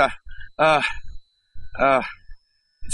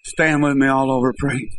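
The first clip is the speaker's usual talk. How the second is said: ordinary delivery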